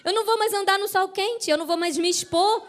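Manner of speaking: acted-out jubilant relief; woman rejoicing. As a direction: celebrate as the relieved woman, pitch rising. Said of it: "A mulher deve ter pensado assim".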